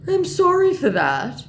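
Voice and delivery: slow, high, loud apology; hammed-up scammer sorry; apologetic mock scammer. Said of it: "in response to my mother".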